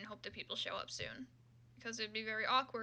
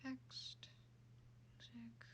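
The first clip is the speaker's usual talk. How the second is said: whisper to self; 13 dB quieter, much slower than usual. Real, not acted. Muttering while clicking through menus.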